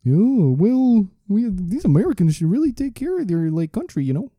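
high, hammed-up Canadian voice; Canadian accent. Canadian judging how Americans run their country, mock Canadian accent. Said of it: "a Canadian jumped in there".

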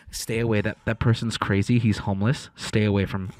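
low, urgent warning voice; wary bystander. Retold or imitated. Bystanders walking away from him, low warning. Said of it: "not only do they walk away".

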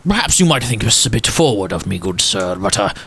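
lightly voiced old gent, hushed in places; a hint of a sorrowful old gentleman remembering; wistful old gentleman. Walsworth asks a favor.

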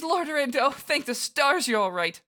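high-pitched character voice, gushing relief; relieved devotee. Acted. Nagi to her lord found alive, relieved. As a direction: cry out in high, fervent relief.

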